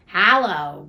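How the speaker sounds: loud gruff growl; growled greeting. A narrator's reading. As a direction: growl it out loudly, feigning grumpiness. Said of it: "growled Scrooge in his accustomed voice".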